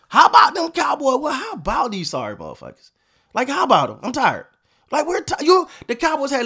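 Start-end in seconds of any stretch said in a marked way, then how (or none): 0.1-1.2 higher and louder, hyped-up catchphrase; mocking the old fan slogan with fake cheer; mock rallying cry. Fan catchphrase, then bitter twist. 1.2-2.9 a fed-up fan turning the slogan sour; bitter sarcasm. Real, not acted.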